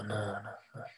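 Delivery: retold dread, barely breathed out; terrified whisper. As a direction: whisper it in pure terror. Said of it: "con un susto terrible".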